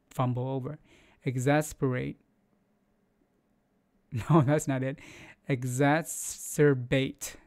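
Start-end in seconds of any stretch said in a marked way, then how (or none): none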